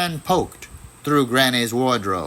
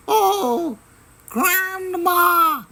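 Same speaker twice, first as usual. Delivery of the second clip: much higher, slow girl's voice, a hint of sobbing; much slower than usual. A narrator's reading. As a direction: sob out relief to grandma.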